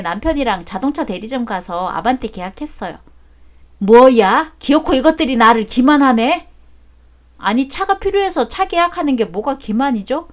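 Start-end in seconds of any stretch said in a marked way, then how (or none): 3.8-6.5 loud, indignant father; outraged. Acted. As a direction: angrily protest at being deceived. Father learns car bought.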